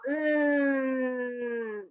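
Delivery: humming like a bee; bee hum. Shown as a demonstration. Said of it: "you'll go".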